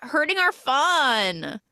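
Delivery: high, very slow whine; whiny mock protest. Party friends whining at the buzzkill, high and slow. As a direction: drag it out like a spoiled party girl.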